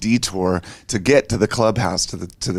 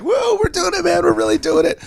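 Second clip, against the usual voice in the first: much higher, thrilled mock cheer; a hammed-up yell of road-trip glee, pitched way up; excited road trip whoop. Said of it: "we're like".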